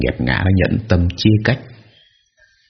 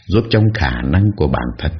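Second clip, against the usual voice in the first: ordinary delivery, nothing marked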